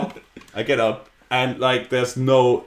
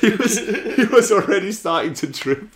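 laughing; 7 semitones higher, slower than usual. Real, not acted. Excited retelling of man falling.

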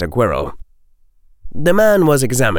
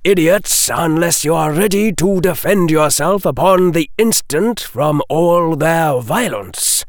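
higher, arch lecture to a rival; condescending Defense Professor; 8 semitones higher, 3 dB louder than usual. A narrator's reading. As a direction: lecture him with lofty disdain.

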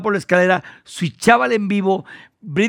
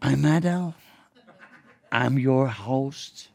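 reassuring host; 6 semitones lower, 8 dB quieter, slower than usual. Retold or imitated. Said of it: "y le dije".

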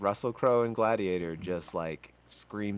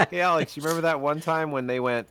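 excited; 8 semitones higher, 8 dB louder, faster than usual. Retold or imitated.

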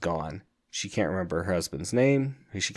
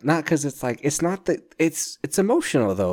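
choked up; 7 semitones higher, 3 dB louder than usual. Real, not acted. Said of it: "I'm, like, almost tearing up".

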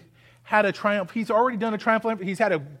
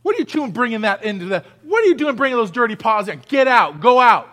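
fast, louder rebuke ending in a shout; scolding the dog; 4 dB louder than usual. Acted. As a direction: chase a muddy dog outside. Scolding the dog's dirty paws.

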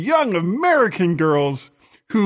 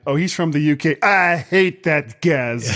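fake French accent. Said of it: "Stupid fake French accent".

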